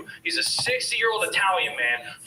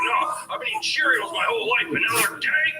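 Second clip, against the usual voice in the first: higher mock rant of an old dad; imitating angry dad; 14 semitones higher than usual. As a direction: ham up the cranky father, pitched up. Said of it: "And he's like".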